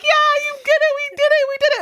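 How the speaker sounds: very high, squealing celebration; mock cheering passengers. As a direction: cheer like relieved passengers, hammed up. Passengers cheering after landing.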